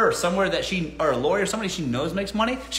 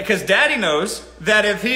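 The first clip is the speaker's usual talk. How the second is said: ordinary talk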